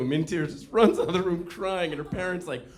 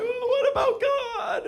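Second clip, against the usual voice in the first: put-on very high, drawn-out child's wail; exaggerated squealing despair; mock crying; 4 dB louder, slower than usual. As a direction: cry it out slowly as a distraught kid. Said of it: "and she's crying".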